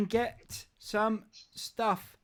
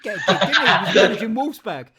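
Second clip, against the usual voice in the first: laughing; 11 dB louder than usual. Real, not acted. Host laughs recalling McLaren.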